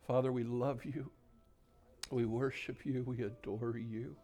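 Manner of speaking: soft, reverent praying with long pauses; quiet, pleading pastoral prayer; hushed prayer. Real, not acted. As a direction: speak intimately to God, nearly whispering at times. Leads the congregation in prayer.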